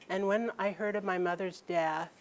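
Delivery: ordinary delivery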